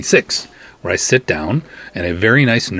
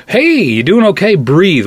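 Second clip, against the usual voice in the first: higher and louder, urgent concern; urgent call; 10 semitones higher, 6 dB louder than usual. Retold or imitated. Nurse and doctor to the pale patient, urgent and loud. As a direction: call out to snap him back. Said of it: "they both kinda went".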